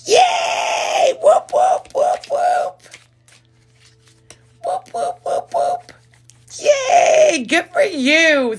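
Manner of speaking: cheering the bonus winner at the top of her voice; excited cheering. Real, not acted. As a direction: scream with joy for the winner.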